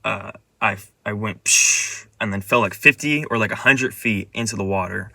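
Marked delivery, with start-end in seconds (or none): 1.4-2.1 long airy "psh" of flying off; imitated whoosh. Dreamer retelling the launch off the slope, imitated whoosh. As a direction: make the noise of shooting through air.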